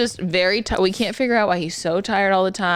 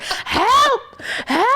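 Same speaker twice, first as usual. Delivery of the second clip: much higher hammed-up cry; mock old man cries; 14 semitones higher than usual. Therapist imitating the patient crying for help again. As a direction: wail it like the patient. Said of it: "holding the fucking call light".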